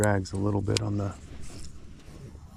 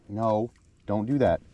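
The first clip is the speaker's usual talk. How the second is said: ordinary talk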